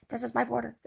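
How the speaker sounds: high-pitched talking dog; dog's voice. Acted. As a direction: play a possessive dog in a high little voice. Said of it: "that's what he's telling the dog".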